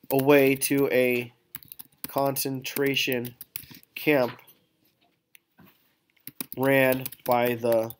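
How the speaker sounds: slow, measured dictation of a model text; slow dictation while typing. Shown as a demonstration. Teacher typing a sample monologue for the class, slow dictation. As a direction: read out slowly, word by word, as you type.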